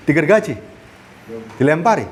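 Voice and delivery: ordinary talk, nothing marked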